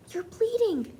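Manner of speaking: high, sudden alarm. A narrator's reading. Grandmother startled to see the mouse bleeding, alarmed. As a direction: gasp it out in shock.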